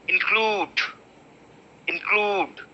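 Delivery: include with a very short in, stress on CLUDE. Shown as a demonstration. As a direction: clip the in, land on CLUDE. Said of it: "In is very short".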